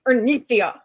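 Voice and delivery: speaking through clenched teeth like a mom; scolding whisper-yell through teeth; through gritted teeth. Retold or imitated. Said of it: "he's speaking through his teeth like a mom".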